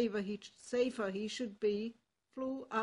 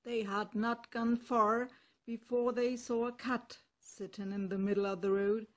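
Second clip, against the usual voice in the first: ordinary delivery, nothing marked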